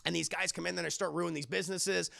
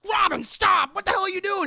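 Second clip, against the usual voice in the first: much higher, loud alarmed yell; shouting Batman; 12 semitones higher, 11 dB louder than usual. Retold or imitated. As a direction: yell at Robin to stop. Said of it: "And Batman's like".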